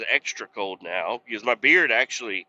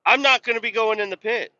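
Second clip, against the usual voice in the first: indignant protest; 10 semitones higher, 4 dB louder than usual. Retold or imitated. Retold protest to boss.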